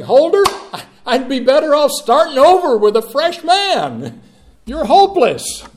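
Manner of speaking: high, loud, exasperated declaration; imagined rebuke. Pretend. Preacher voicing God giving up on him, loud rebuke. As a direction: throw it out like a fed-up judge. Voices God's imagined rejection.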